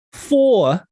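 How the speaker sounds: can't believe the rating; indignant disbelief. Real, not acted. Reading a four-star review, outraged disbelief.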